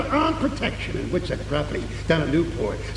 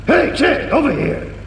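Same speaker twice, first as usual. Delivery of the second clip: calling out; 10 semitones higher, 4 dB louder than usual. Acted. Calling out to Ted.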